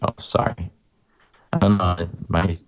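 choppy broken-up connection; glitchy signal distortion; garbled audio. Real, not acted. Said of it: "you sound a little garbled".

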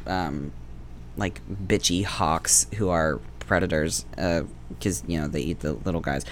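ordinary talk, nothing marked

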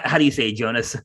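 normal talking voice

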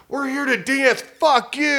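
high-pitched, mildly indignant heckle; mock indignant crowd. Crowd heckling the comedian walking through.

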